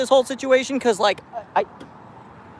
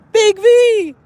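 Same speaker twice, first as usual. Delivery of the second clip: loud, high call across the street; high pet call, louder; 12 semitones higher, 6 dB louder than usual. Real, not acted. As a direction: shout the cat's name, high. Calling cat by name.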